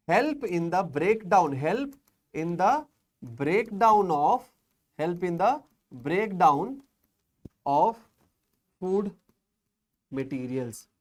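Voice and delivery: "breakdown... of... food... materials" in pieces with long pauses; halting, word-by-word teacher dictation; slow dictation. Shown as a demonstration. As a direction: dictate it bit by bit, as if writing along. Teacher dictates phrase as she writes.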